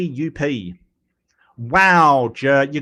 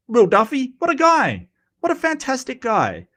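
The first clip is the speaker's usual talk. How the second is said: much higher, gushing over the name; excited praise; 10 semitones higher than usual. Real, not acted. Praising the flat-earth experimenter to chat, thrilled and high. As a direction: rave about him with real delight.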